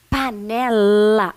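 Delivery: slow "Panela" with a long, loud final la; stressing the syllable lá. Shown as a demonstration. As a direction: stretch the l in the last syllable of Panela. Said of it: "como que eu construo a sílaba lá". (destacando o "la" de "Panela", bem prolongado)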